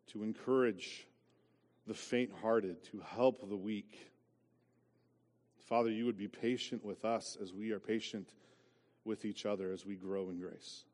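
soft, low pastoral prayer. Acted. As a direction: pray quietly and humbly.

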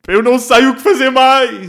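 high, loud crying wail; a hammed-up weeping leader; mock sobbing despair. Prime minister breaking down before the nation, hammed-up wail. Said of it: "Imaginem o desespero total".

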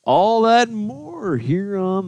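slow, booming announcer tagline; big slow show-intro delivery. Acted.

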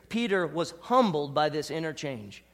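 ordinary delivery

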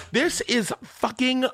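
high, slow, drawn-out mock outburst; mock scream. Chaperone teacher bursting into cabin, mock screaming. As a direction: ham up a teacher losing it. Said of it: "Like, screaming".

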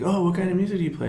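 rattled-off mock small talk; curious acquaintance. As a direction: imitate a chirpy casual asker. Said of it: "when someone says".

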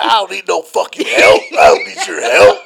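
loud drunken refusal, acted out; imitated defiant shout. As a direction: yell off the helpers. Acting drunk Red refusing help.

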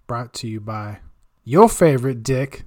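normal talking voice

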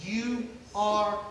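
hushed, slow, breathy voice of God; tender whisper. Acted. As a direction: lean in and whisper it gently.

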